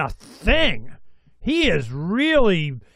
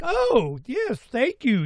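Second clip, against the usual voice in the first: mock grateful wife; 6 semitones higher, 4 dB quieter, slower than usual. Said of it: "was she like".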